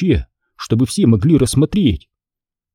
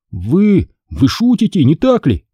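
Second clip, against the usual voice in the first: nervous stammer on a raised pitch; 6 semitones higher than usual. A narrator's reading. Boy doubting the threatening man, stammering. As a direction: trip lightly over the first word. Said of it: "заикаясь, проговорил я".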